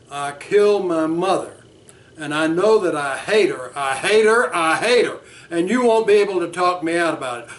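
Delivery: loud, bitter insistence that he hates his mother; angry client. Acted. Client Bert venting rage at his therapist, angry. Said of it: "I'll be Bert now".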